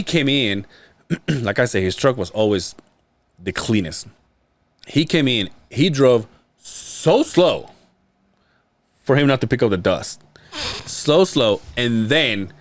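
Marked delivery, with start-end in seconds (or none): 5.7-7.7 slowed down. Real, not acted. Describing the slow careful driving.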